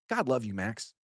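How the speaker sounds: ordinary talk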